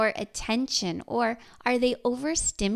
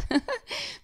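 laugh; 9 semitones higher than usual. Real, not acted. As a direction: chuckle knowingly at yourself. Laughs at her own sarcasm example.

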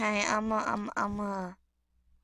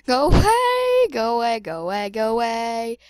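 loud panicked plea; 10 dB louder than usual. Acted. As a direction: drive it off with loud repeated cries. Panicking at a threat in game.

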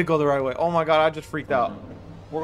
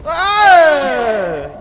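scream; 9 semitones higher, 10 dB louder than usual. Real, not acted. Sudden scare.

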